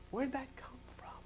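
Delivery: hushed whisper, mock bewildered. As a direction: whisper the question. Pointed question.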